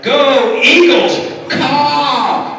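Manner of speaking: high, loud, drawn-out cheerleader yell; stretched-out stadium cheer with a caw; cheer shout. Shown as a demonstration. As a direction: belt it like a pep rally cheer. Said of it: "and shout".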